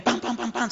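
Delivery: high, rapid "pam pam pam pam"; imitated door slams. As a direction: imitate doors banging shut one after another. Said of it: "cerrando las puertas". (imitación aguda de portazos)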